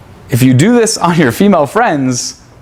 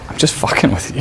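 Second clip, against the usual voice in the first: whisper; 4 dB quieter than usual. Acted. Whispered aside in the demo.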